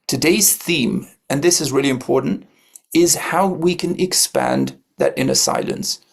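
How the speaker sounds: ordinary talk, nothing marked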